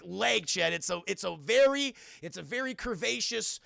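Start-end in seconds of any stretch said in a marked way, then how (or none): none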